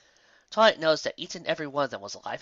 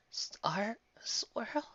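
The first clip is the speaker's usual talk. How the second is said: surprised whisper; 10 semitones higher, 8 dB quieter than usual. Acted.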